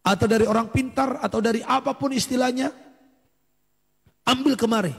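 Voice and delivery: re-enacted demand, pitched high; acted interrogation ending in a command; stern demand. Preacher re-enacts confronting the household.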